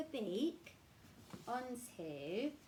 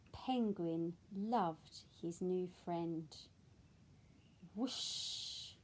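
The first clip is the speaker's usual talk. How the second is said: put-on reading to children; 4 dB louder, slower than usual. A narrator's reading. Reads picture book aloud.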